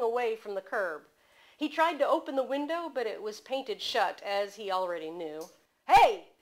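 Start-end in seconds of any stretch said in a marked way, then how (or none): 5.9-6.3 loud yell after the car. A narrator's reading. Man slapping the window at the departing car, loud yell. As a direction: shout it out to stop her. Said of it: "he yelled".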